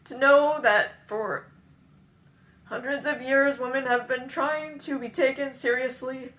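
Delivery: high, strained and upset; real distress rising high and loud. Real, not acted. Confessing regret over women not taken seriously, upset. Tearful regret about own past.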